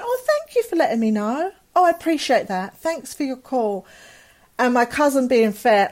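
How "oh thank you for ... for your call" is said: high, sing-out phone politeness, put on; imitating a falsely pleasant thank-you; feigned polite gratitude. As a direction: act as if it's an ordinary courtesy call. Covering up bad news on phone.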